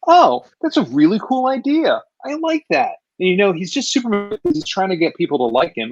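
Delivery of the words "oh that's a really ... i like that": high-pitched, pleased approval; delighted reaction. Retold or imitated. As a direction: retell being pleasantly won over. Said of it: "I'm like".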